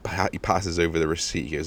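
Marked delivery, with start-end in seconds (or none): none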